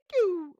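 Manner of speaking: a high, loud cartoon "pew" sound. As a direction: pop like a cartoon snapping. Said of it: "like a cartoon".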